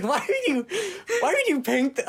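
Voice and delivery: high, incredulous question through laughter; retold disbelief, breaking into giggles; laughing retelling. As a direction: act baffled while cracking up. Cracking up retelling his baffled reply.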